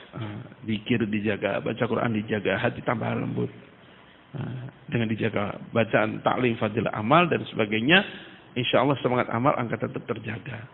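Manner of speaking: soft earnest exhortation. Acted. Preacher urging the gathering to love of Allah, hushed and tender. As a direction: drop to a hushed, heartfelt plea.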